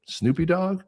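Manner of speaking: daughter's hesitant high query; mispronouncing the name with a rising question; high unsure question. Retold or imitated. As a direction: ask it high and unsure, like a kid guessing.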